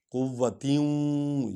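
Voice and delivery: قُوَّةٍ slow, waw pressed and said twice, quwwah; stress on the doubled waw. Shown as a demonstration. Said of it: "وا پر stress آئے گا".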